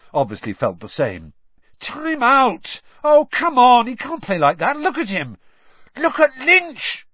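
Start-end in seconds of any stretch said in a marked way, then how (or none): 1.8-7.1 high, urgent, loud yell. A narrator's reading. Harry at the match protesting about the Irish seeker, yelling. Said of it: "Harry yelled".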